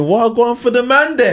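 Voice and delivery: high-pitched incredulous question; baffled, voice shooting way up; mock bafflement. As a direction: squeal it in comic disbelief. Acted reaction to an accent.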